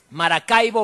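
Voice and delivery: usual speaking voice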